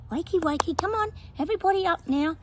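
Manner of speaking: chirpy morning call to get everyone out of bed; mother waking kids. Retold or imitated. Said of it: "you can hear this little voice going".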